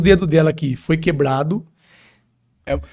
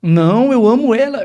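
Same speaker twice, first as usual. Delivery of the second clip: put-on little girl's voice; 7 semitones higher, 4 dB louder than usual. Pastor voices the little girl.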